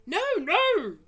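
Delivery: loud, high frightened cry; panicked denial. Acted. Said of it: "cried the B F G".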